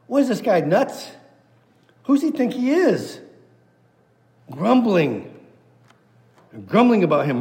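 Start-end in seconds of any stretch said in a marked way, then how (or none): 0.1-3.2 mock incredulous. Voicing the grumbling crowd.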